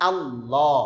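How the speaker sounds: "আল্লাহ" with lips wide open and a full আ; open-lipped Allah. Shown as a demonstration. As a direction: model "আল্লাহ" with an open mouth. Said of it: "আল্লাহ বলার সময় যেরকম ঠোঁট খোলা থাকে". (ঠোঁট খোলা রেখে "আল্লাহ")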